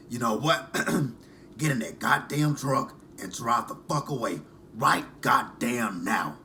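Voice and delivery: pissed-off smoker's voice impression; Angela. Angela tells Tony to drive away, imitated pissed smoker's rasp. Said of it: "you can hear that smoker's voice".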